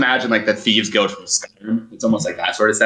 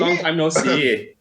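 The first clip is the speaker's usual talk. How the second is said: slow, higher camp German lilt; effeminate German man; 8 semitones higher than usual. Acted. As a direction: coo it like a flirty German barkeep. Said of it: "effeminate German man".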